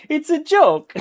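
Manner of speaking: high and loud through laughter; cracking up mid-joke; laughing line, pitched high and loud. Real, not acted.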